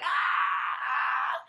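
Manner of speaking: put-on high baby squeal of excitement; imitated excited squeal. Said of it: "He squeals out".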